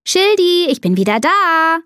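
high, singing-out greeting; excited calling. A narrator's reading. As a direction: call happily across the garden. Child calls pet on returning.

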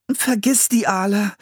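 put-on high girl's voice, shivering; teeth chattering. A narrator's reading. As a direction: speak through chattering teeth. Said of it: "sagte Anne mit klappernden Zähnen".

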